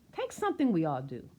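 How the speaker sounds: ordinary delivery, nothing marked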